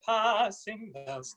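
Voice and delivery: slow Passing bells with a flattened ah; flattened British ah. Shown as a demonstration. Coach models the vowel for the singer to copy: flattened ah. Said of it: "it's like a British ah, but it's flattened".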